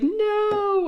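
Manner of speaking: high, drawn-out mock wail of protest; freaking out. Daughter told she needs retesting, imitated freaking out. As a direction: imitate a kid's long, high whine. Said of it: "she started freaking out".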